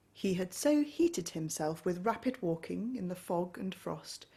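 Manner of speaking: usual speaking voice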